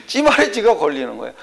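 real amusement breaking into the words; laughing while talking. Real, not acted. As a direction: chuckle through the teasing remark. Amused at her self-contradiction. (웃음 섞인 말투)

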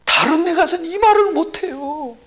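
high-pitched imitation of the caller; acted confiding complaint. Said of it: "그랬더니 뭐라 그러지". (높은 목소리로 하소연 흉내)

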